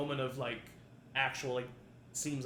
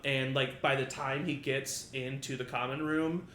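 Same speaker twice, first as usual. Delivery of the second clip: ordinary talk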